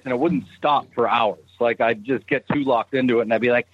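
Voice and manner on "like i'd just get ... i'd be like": ordinary talk, nothing marked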